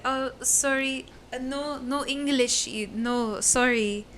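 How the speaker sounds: slow, halting put-on foreign accent; pretended non-English speaker. Brushing off men asking for money, halting fake foreign accent. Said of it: "I pretend I don't speak English".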